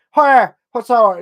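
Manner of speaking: loud, high Northern Irish greeting; Northern Irish accent. Acted. Showing why the Northern Ireland accent is fun, loud Northern Irish accent. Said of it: "the Northern Ireland accent is brilliant".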